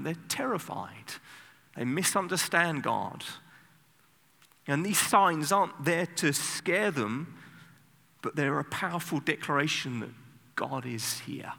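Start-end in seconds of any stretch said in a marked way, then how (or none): none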